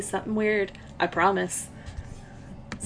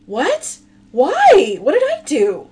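high, loud, indignant questions; loud protest; 6 semitones higher, 9 dB louder, slower than usual. Acted. Ron answering Bale's threat, loud protesting. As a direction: fire back in mock innocence.

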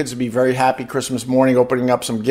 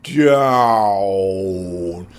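drawn out slow; 4 dB louder, much slower than usual. Shown as a demonstration. Showing how he slows way down, words drawn out long. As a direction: stretch out slow way down, dragging down. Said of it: "showing slowing way down".

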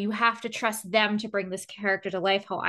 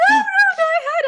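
ordinary talk

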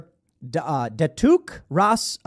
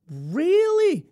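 high, drawn-out surprised question; exaggerated interviewer's amazement; mock astonishment; 11 semitones higher than usual. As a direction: stretch it up high, hammed-up surprise. Acted interviewer reacts to answer.

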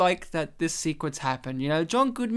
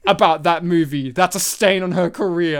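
loud, mildly hammed-up rebuke; mock outrage; 2 semitones lower, 7 dB louder than usual. Coworker defending her career from that movie, mock outraged. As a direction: shut him down like an offended fan.

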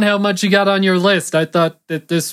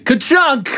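a high, punchy mock stamp noise; rubber stamp thunk; 9 semitones higher, 3 dB louder than usual. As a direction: say it like a stamp slamming down. Mock stamp sound closing turn.

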